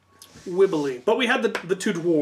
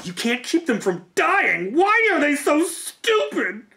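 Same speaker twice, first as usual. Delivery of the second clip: slow, rising disbelief, much higher; exasperated; 10 semitones higher, 4 dB louder, slower than usual. Retold or imitated. Player venting about weak party members, exasperated. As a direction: throw up your hands at the fools.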